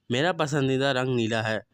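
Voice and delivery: normal talking voice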